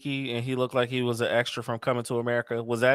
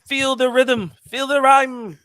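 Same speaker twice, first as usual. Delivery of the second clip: high, rhythmic Jamaican movie chant; Cool Runnings impression; 11 semitones higher, 4 dB louder, slower than usual. Co-host doing his best accent on request, Cool Runnings chant. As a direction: chant it like the Jamaican bobsled team. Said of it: "imitating, uh, uh, Cool Runnings".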